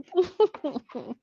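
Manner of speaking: high falling giggle. Real, not acted. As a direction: giggle at the joke. Laughing at a collage.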